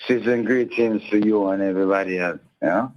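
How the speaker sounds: ordinary talk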